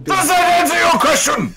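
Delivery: high villain shout; dark lord. Acted. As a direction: demand it from on high. Villain demanding at host.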